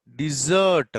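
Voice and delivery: "డిజర్ట్" loud, as di-ZURT; pronunciation model. Shown as a demonstration. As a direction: lean on a long ZURT in "డిజర్ట్". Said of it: "దీన్ని ఎలా పలకాలంటే". ("డిజర్ట్" అని గట్టిగా, సాగదీసి పలకడం)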